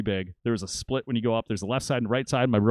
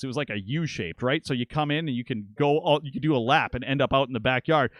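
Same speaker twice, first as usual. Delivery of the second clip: usual speaking voice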